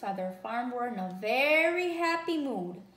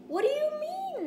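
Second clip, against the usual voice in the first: much higher, startled question; shocked question. A narrator's reading. Shocked Cow asks the angry animals, high and startled. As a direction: ask in shock. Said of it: "Cow was shocked".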